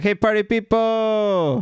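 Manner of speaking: loud, high, drawn-out DJ call; hyped DJ. Acted. DJ calling out to the school dance, hyped shout. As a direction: holler it slowly like a party DJ.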